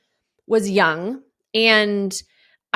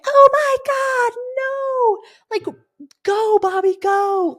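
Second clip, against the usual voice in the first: high, drawn-out shriek of panic; frantic yell to get the boat moving; panicked scream; much slower than usual. Retold or imitated. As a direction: shriek it at the driver. Panicked yelling at approaching snake.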